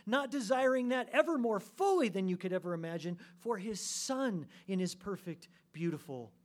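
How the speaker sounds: impassioned preaching. Real, not acted. Preacher picturing the eternal Father's delight, loud and fervent. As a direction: keep preaching high and strong, gradually easing.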